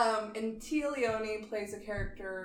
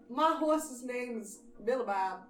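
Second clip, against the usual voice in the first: put-on country accent; 2 semitones higher, slower than usual. Joking about horses, slow put-on country drawl. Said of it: "real country".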